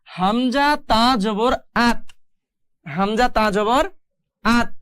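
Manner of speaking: slow, measured letter-by-letter reading ending on a joined "আত"; joined spelling-out. Shown as a demonstration. Teacher shows learners how to join the letters, slow spelling-out. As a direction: spell out "হামজা তা জবর", then close it firmly as "আত". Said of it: "ঠিক একইভাবে মিলায় পড়তে হবে".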